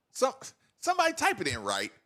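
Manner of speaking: ordinary talk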